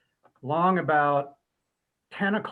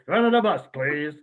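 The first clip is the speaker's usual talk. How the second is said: laughing; 4 dB louder than usual. Retold or imitated. The teller repeats the band's retort while laughing. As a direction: crack up mid-line. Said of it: "we all looked at him and went".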